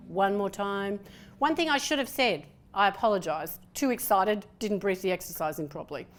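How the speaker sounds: normal talking voice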